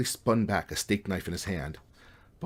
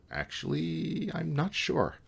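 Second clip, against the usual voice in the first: unsure; 10 semitones higher, much slower than usual. A narrator's reading. Uncertain answer to the garlic question.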